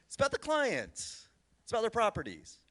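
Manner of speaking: high-pitched, drawn-out insistence; emphatic plea. Real, not acted. Panelist scolding an industry obsessed with its own faces, emphatic plea. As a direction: pitch it up high and stretch it out to drive the point home. Passionately correcting the industry's self-focus.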